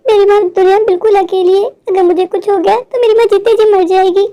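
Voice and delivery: ordinary talk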